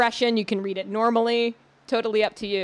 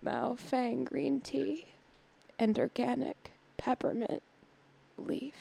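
soft, slow sensual read with lingering pauses; mock sensual voice; 10 dB quieter, slower than usual. Host reading tea label as running gag, mock sensual voice. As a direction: purr the tea label very softly and slowly. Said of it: "I tend to read it in, like, a sensual voice".

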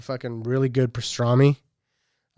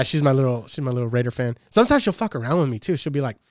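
usual speaking voice

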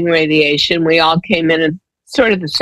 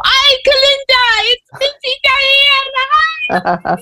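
much higher, slow drawn-out greeting; greeting Diane; slower than usual. Retold or imitated. Bronx women greeting Diane each morning, much higher, slow. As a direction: stretch each word out high like a friend calling hello. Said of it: "they would go".

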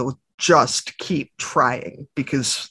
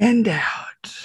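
whispered breath cue; 5 semitones higher than usual. Retold or imitated. Daughter guiding her brother's breathing, whispered. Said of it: "And she goes".

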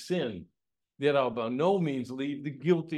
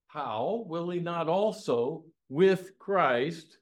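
slow drawn-out question; 4 semitones higher, much slower than usual. Acted. Preacher voicing God's question to the church, slowly. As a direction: stretch each word of the question slowly. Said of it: "Then he says".

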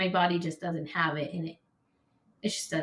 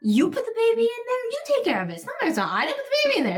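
much higher, louder shrill outburst; shrieking at God; 5 dB louder, much faster than usual. Retold or imitated. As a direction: fling the blame at God at the top of your voice. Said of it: "I was like".

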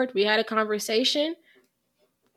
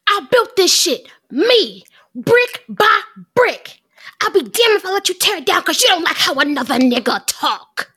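dramatic monologue, loud and raised; grand tragic wailing; 7 semitones higher, 6 dB louder than usual. Acted. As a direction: deliver it like a wounded villain. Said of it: "more dramatic, uh, monologue".

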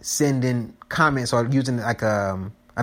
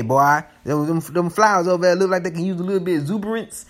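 higher, fast put-on voice; reading a comment in a voice; 5 semitones higher than usual. Reading a viewer comment aloud to listeners, playful put-on voice. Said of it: "That comes from the comments".

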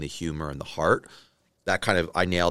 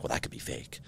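whispered; 11 dB quieter than usual. Retold or imitated. Girlfriend answering through the door.

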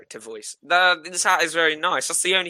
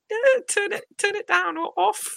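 high-pitched put-on passenger voice; squeaky high voice. Passenger asking the bus driver, in a squeaky high voice. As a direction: pitch way up in a silly character voice.

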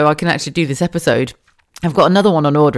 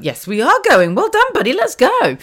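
put-on much higher, bright praise for a small child; praising toddler; 12 semitones higher than usual. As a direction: cheer your son on in a high parent voice. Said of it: "I went".

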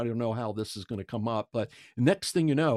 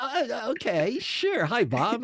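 flustered reply; 10 semitones higher than usual. Retold or imitated. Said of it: "I'm like".

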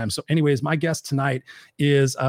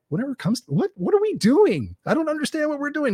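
confused; 12 semitones higher than usual. Retold or imitated. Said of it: "And I'm like".

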